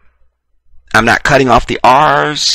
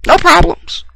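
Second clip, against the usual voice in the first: 'No problems' jumped up much higher in a mocking squeak. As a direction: toss off 'No problems' in a sudden high falsetto mock. Repeats phrase, then mock version.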